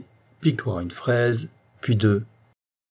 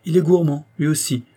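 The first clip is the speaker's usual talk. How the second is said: put-on higher, livelier, emboldened girl; gaining confidence, pitched up; growing bolder; 6 semitones higher, 5 dB louder than usual. A narrator's reading. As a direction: open up eagerly, voice lifted. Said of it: "s'enhardissant peu à peu".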